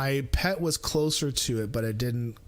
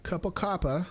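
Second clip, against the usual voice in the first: light mock British accent, pitched up; 6 semitones higher than usual. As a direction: put on a playful British accent. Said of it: "I gotta do the accent".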